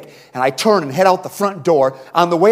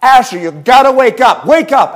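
loud, higher yell up at a window; yelling for his son; 7 semitones higher, 8 dB louder than usual. Retold or imitated. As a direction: shout to wake a sleeping boy upstairs. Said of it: "I'm yelling".

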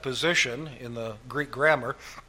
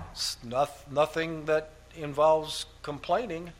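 usual speaking voice

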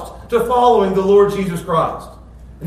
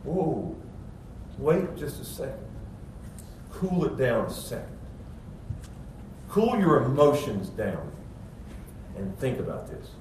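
calming speed bump; 8 semitones lower, 10 dB quieter than usual. Retold or imitated. Jesus slowing the eager scribe, soft calm restraint. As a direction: lower and soft, unhurried. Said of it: "Jesus is like a speed bump".

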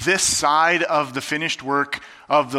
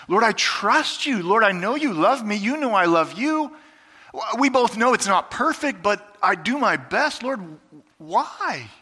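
high, pleading cry rising to the Lord; anguished prayer; 6 semitones higher than usual. Retold or imitated. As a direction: pour out a hurting prayer, pitched up. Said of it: "tempted to ask Jesus".

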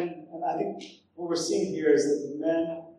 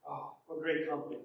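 ordinary delivery

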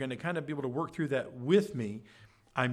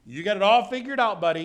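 loud, higher, mock-condescending jab; 5 semitones higher, 9 dB louder, faster than usual. Preacher mocking a friend who thinks he knows it all, loud sarcastic jab. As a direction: talk down to him like a smug know-it-all.

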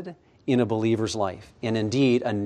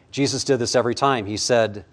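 normal talking voice

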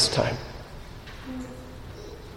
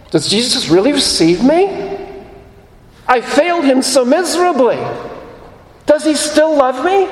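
anxious doubter; 8 semitones higher than usual. Retold or imitated. Voicing a doubting worshipper.

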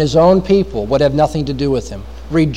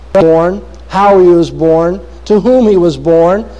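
normal talking voice

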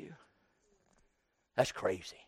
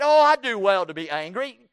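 louder, defiant sass; talking back; 4 semitones higher, 6 dB louder than usual. Acted. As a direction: answer back rudely, with an Oh. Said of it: "Talking back".

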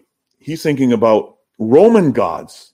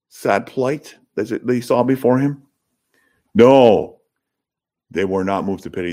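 normal talking voice

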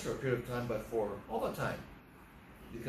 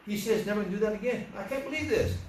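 high-pitched, mounting incredulity; acted disbelief; 7 semitones higher than usual. Driver finds same boy throwing rocks again, acted disbelief. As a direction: play an exasperated driver, pitch climbing to the end.

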